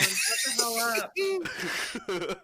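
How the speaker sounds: loud, drawn-out shout; in-character yell. Acted. Groggy sleeper yelling at whoever is knocking, shouted out loud. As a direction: holler it slowly from bed. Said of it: "He's yelling".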